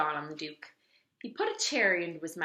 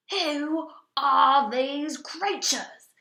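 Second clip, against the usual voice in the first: high, loud, very slow bellow; bellowing duke; 8 semitones higher, 9 dB louder, much slower than usual. A narrator's reading. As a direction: roar it out, drawing each word. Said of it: "he bellowed".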